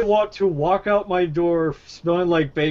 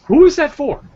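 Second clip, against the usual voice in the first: mock baffled question, pitched up and louder; incredulous; 10 semitones higher, 6 dB louder than usual. Friend retelling a bizarre snack, incredulous. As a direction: ask it like nobody could want bacon cookies. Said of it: "I was like".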